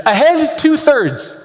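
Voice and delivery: high, slow, drawn-out command; called-out order. Retold or imitated. Captain calling an order to his crew, high and slow. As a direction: bark it like a sub captain.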